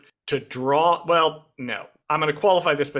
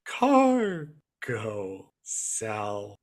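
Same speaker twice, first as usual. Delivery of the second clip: very slow, low sleepy drawl; super slow sleepy sloth; 6 semitones lower, 4 dB quieter, much slower than usual. Retold or imitated. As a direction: stretch it out like a drowsy sloth. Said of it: "I just imagine him speaking like the, the D M V sloth from Zootopia".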